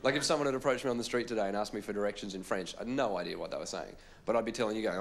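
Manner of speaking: usual speaking voice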